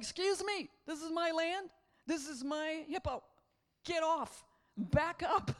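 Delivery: lightly voiced lion telling the croc off; sassy lion. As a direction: put him in his place with attitude. Lion defending his land.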